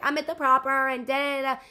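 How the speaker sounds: loud, high drunk girl impression. Mimicking the drunk girl on his phone, loud and high. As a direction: shout it like a tipsy girl on the phone. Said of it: "a drunk girl being like".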